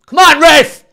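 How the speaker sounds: loud, high angry shout at the ref; yelling from the sidelines. Real, not acted. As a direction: bellow it at the referee from the stands. Fan shouts at referee.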